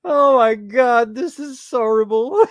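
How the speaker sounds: loud, high outcry between laughs; high, loud, laughing dismay; amused horror. Real, not acted. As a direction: wail it while cracking up.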